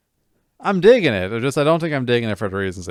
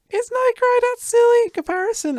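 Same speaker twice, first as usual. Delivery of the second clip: much higher squeaky mock question; 6 dB louder than usual. Wondering aloud while playing, much higher mock voice. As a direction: pitch it way up as a silly aside. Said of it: "playing this going, like".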